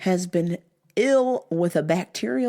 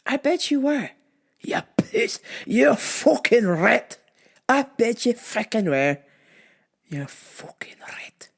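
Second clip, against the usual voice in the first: retold insult; 4 semitones higher than usual. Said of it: "I was like".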